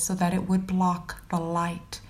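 plain reading voice

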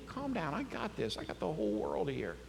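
soft, hushed calming words; quiet, intimate assurance; gentle reassurance. Retold or imitated. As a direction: settle them down quietly. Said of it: "You know what he says to you?".

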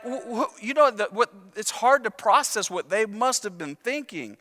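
normal talking voice

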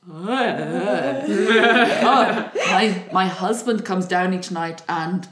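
nervous laughing disgust; horrified laugh. Retold or imitated. New bride finding the corpse, laughing in disgust. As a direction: laugh in queasy horror.

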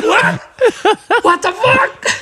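high, laughing what the fuck; stunned disbelief. Retold or imitated. Adelmo hearing the birthday was fake, stunned laugh. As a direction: react like you just got fooled. Friend learns it's not his birthday.

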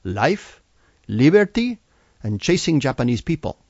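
put-on proudly in a Salvadoran accent, "liberty" jumping high and loud. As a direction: recite it with pride in a Salvadoran accent. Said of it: "very proudly".

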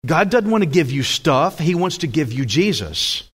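normal talking voice

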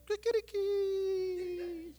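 high, drawn-out crowing; slow, high rooster call; rooster crow imitation. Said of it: "he heard the rooster going like".

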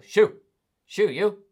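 loud high shoo; shooing shout. Shown as a demonstration. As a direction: shout it at a spider. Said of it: "you go".

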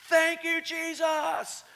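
high, loud yelled thanks; yelling praise. Retold or imitated. Preacher reliving praising Jesus in his driveway, high yell. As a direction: shout it up to Jesus. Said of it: "I just began to yell".

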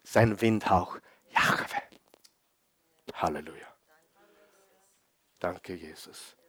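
very soft, low prayerful murmur; gentle breath of wind. Shown as a demonstration. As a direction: drop to a hushed, reverent near-whisper. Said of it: "sein leises Säuseln".

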